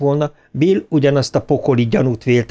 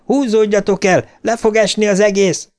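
shouted warning; 8 semitones higher than usual. A narrator's reading. Ben warns his friends it will fall, shouted warning. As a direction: call out urgently, pitched well up. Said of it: "kiáltott rájuk Ben".

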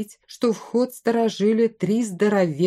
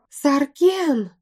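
high, desperate call; wailing cry; 8 semitones higher, 3 dB louder than usual. A narrator's reading. Woman begging the bandit leader to act, wailing. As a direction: cry the name out at a raised pitch. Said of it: "завопила женщина".

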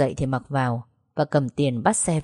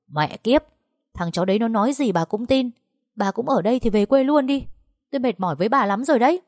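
higher, heated rant at his mother; angry cursing; 6 semitones higher than usual. Acted. Son cursing at his mother through the wall, angry. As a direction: snap at her, fed up. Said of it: "tiếng Vũ Kỳ chửi rủa".

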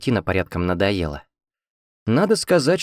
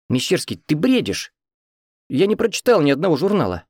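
higher, lightly voiced exasperation; exasperated wife; 7 semitones higher than usual. A narrator's reading. Wife objecting to her husband's sudden idea, exasperated. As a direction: protest with mild disbelief.

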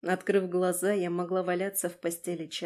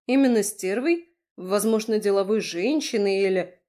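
loud, affronted disbelief; taken aback; 8 dB louder than usual. A narrator's reading. Woman disputing the name he gave her, taken aback. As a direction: push back loudly, offended and surprised.

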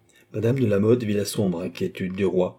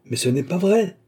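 high, rising cry of denial; desperate innocence; 9 semitones higher, 4 dB louder than usual. A narrator's reading. Accused queen protesting to the king, desperately indignant. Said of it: "avec l'accent d'une innocence désespérée".

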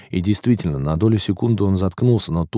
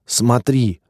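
higher-pitched triumphant cry; jubilant, raised voice; exulting; 5 semitones higher, 4 dB louder, much slower than usual. A narrator's reading. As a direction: urge them to look, exultant. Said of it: "ликовал молодой".

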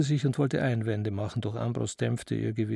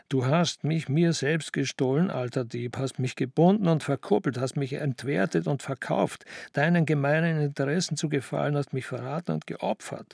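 usual speaking voice

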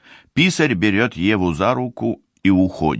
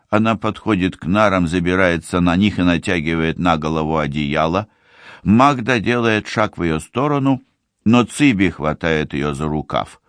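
plain reading voice

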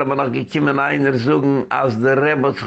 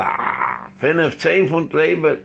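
normal talking voice

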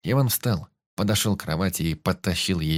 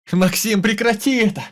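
very high, loud desperate cry; scream with all his might; 7 dB louder than usual. A narrator's reading. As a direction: scream for help at full force. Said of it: "закричал, что было сил".

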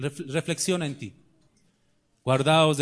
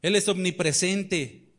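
normal talking voice

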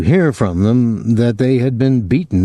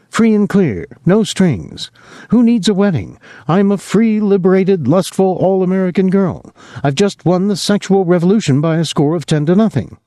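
put-on higher, taunting young woman; Julie, lightly voiced mocking; 9 semitones higher than usual. A narrator's reading. Julie mocks Rudolph about marriage, lightly voiced taunting. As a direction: toss off the boasts with a hint of scorn.

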